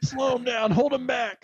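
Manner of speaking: high mock yell, echoing the cook. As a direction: parrot the swamped cook's yelling.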